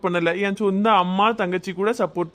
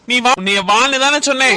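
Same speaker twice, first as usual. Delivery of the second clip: louder, higher insistent order; loud command; 5 semitones higher, 6 dB louder than usual. Acted. Husband orders his wife to come with him, loud. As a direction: drag her along with your voice.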